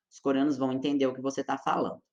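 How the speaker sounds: ordinary delivery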